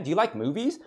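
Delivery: ordinary talk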